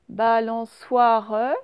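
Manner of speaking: Balançoire drawn out very slowly with a rise; slow Balançoire right down for the class; slow dictation. Shown as a demonstration. Teacher sounding out Balançoire syllable by syllable. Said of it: "c'est juste avant le wa".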